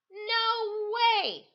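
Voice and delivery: high, loud flat refusal; farmer's refusal. Acted. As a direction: slam the door on the idea. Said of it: "said Farmer Brown".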